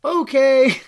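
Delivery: high, loud "oh" of surprise; startled, then loudly accepting the odd news; taken aback. Retold or imitated. Reacting to a stranger's message about her mother, taken aback.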